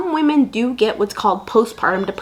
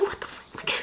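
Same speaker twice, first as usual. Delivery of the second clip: breathy, hushed swear; whispered aside; whispered curse; 14 dB quieter than usual. Real, not acted. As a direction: curse it under your breath.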